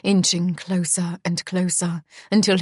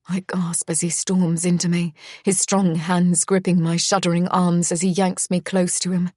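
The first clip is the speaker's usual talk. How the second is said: ordinary delivery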